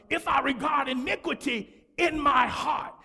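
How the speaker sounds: preaching at full force. Acted. Pastor pressing the congregation on sin, preaching full force. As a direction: hammer the verse home to the church.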